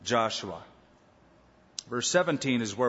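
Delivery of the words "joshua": "Joshua" the English way, JOSH-oo-uh, low; pronounced as written. Shown as a demonstration. Preacher returning to his usual name for him, "Joshua" pronounced as written. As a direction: say "Joshua" as spelt, with English J, dropping lower. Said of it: "as I pronounce it as it is written".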